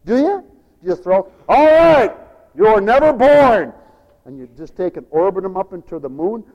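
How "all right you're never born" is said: loud, slow mock dismissal; fed-up parent. Exasperated parent throwing kid out, loud mock.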